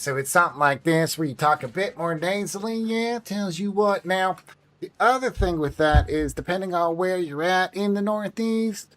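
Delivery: nasal Northerner Yankee twang; Northern Yankee accent. Shown as a demonstration. Explaining US regional accents by example, nasal Northern Yankee accent. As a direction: talk through the nose like a Northern Yankee. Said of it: "you have a Northerner Yankee accent".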